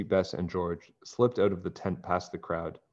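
plain reading voice

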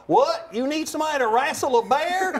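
put-on high, loud Southern accent. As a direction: play the producer with a Southern accent. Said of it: "really funny Southern accent".

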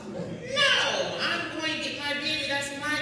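sudden high loud No, then firm; mother's protest. Retold or imitated. Teacher as a mother refusing to let her son be killed, high and loud. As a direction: cry out to protect your baby.

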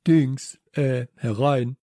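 flustered call, loud at the start. Acted. Herr Nullinger calling visitors in from inside, hesitantly. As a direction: call it out, hesitating over the word. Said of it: "rief Herr Nullinger von drinnen".

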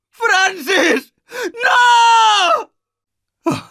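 high desperate shriek; anguished scream. Acted. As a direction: scream his name in horror. Francis dies.

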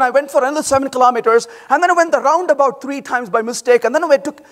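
fast, run-on, turn-by-turn rattle; over-detailed weekend story told rapidly; rambling detail dump. Shown as a demonstration. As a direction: pile on every detail without pausing. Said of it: "just keep going like this".

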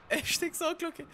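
"Hashtag Sauglocke" said high and light, tossed off; playful high-pitched hashtag callout; high squeaky repeat. Real, not acted.

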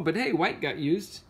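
plain reading voice

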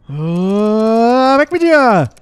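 high, loud panicked cry; panicked shout. Real, not acted. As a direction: shove it away in panic. Panicked shooing.

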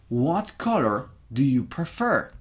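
what color do you prefer? with vowels nearly gone; American model sentence. Shown as a demonstration. Teacher modelling native pronunciation for students. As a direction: let the vowels almost vanish. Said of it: "Escucha el sonido".

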